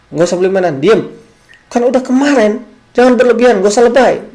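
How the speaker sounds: mock mother yelling at a whining child; angry scolding mother. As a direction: snap at the child, getting shriller. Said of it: "ibu ini mungkin akan berkata".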